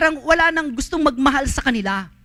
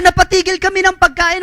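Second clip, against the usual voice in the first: high, loud, animated retelling; excited retelling; 8 semitones higher, 6 dB louder than usual. Retold or imitated. Preacher to congregation, excitedly retelling what she said. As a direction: burst out with the story, pitched up.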